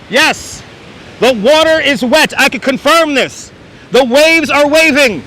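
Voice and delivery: booming mock reporter live on the beach; radio announcer voice. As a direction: announce it like a radio news anchor. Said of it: "I sound like an announcer".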